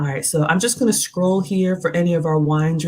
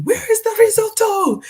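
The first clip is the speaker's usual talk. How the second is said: high, loud mock chef yell; Gordon Ramsay yell; 6 dB louder than usual. Imagining a celebrity chef yelling, mock shout. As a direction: bark it like a furious TV chef. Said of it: "imagination of somebody yelling at me".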